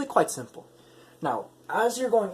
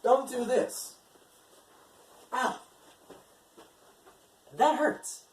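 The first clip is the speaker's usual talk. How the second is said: mock pained; 8 semitones higher, slower than usual. Shown as a demonstration. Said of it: "Here's what I mean".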